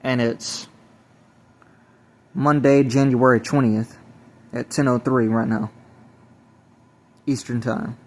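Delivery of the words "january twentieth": normal talking voice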